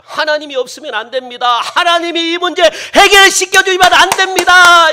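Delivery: building to a loud, high shout; acted urgent shouted confession; crying out. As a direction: plead with God, rising to a full-voiced cry. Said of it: "부르짖는".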